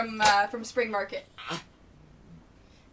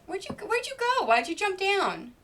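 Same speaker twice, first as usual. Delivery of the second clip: much higher sing-song pet voice; 8 semitones higher, 4 dB louder than usual. Real, not acted. Owner calling after the dog who jumped down, high pet voice.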